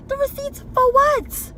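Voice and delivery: high, loud, incredulous mock squeal; shocked mock question. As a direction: squeal it like an outraged mother. Acts out mama's outraged question.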